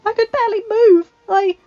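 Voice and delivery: high, strained choking voice; choked out. A narrator's reading. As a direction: force the words through tears. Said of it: "she choked out".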